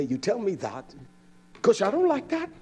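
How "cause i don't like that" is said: high, louder whiny wife; voicing his wife. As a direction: pitch up as the complaining wife. Wife's voice again.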